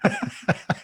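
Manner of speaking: high, giggly cackle; laugh. Real, not acted. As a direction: break into amused giggles. Laughs at own story.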